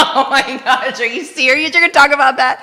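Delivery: laughing, loud, high "are you serious"; embarrassed surprise. Real, not acted. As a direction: caught off guard, laugh it out loudly. Asked to reveal her first word.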